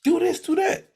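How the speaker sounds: high-pitched mock yell; imitating yellers. Complaining about people who yell at movies, high mock yelling. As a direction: mimic moviegoers hollering orders at the screen. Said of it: "people yelling like".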